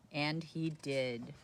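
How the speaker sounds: ordinary delivery, nothing marked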